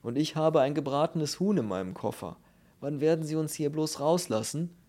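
agitated little man's voice; lamenting bald man. Acted. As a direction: wail indignantly about being locked up. Bald man laments his chicken.

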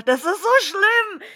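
high, loud horrified outburst; can't bear to watch it, cry out; queasy horror. Real, not acted. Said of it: "Mir wird schlecht und schwindelig".

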